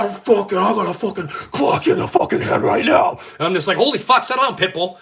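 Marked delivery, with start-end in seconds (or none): 0.0-3.2 loud, high mock threat; imitating a friend shouting a threat. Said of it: "He's like". 3.8-5.0 loud mock calming. Calming the hothead.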